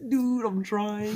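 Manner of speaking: high, drawn-out stoned whine; freaking-out friend. Retold or imitated. Imitating stoned friend who can't fight in the game, high freaked-out drawl. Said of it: "he's, like, freaking out".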